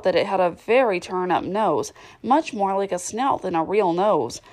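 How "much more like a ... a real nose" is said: plain reading voice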